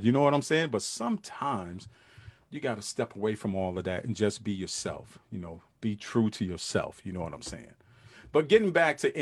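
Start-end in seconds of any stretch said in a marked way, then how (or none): 0.7-7.7 much softer, sincere advice. Real, not acted. Host advising men to be themselves, softly. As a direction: drop it down and mean it.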